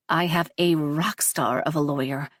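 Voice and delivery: usual speaking voice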